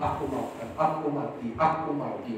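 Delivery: quiet, whiny mock complaint; mock grumbling Israelites. Preacher mimicking the Israelites grumbling in the desert, softly whiny. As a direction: imitate a sulky people grumbling under their breath. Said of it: "ngomel-ngomel".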